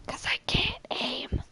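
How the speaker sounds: slow whisper; whispered, drawn-out admission; whispered confession. Real, not acted. Whispered confession of bad aim.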